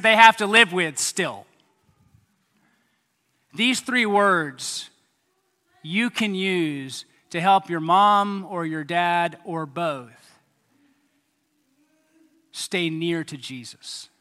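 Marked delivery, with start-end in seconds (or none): none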